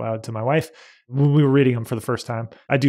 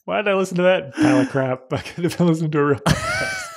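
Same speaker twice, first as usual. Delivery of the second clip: mock listener; 5 semitones higher than usual. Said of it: "they're gonna be like".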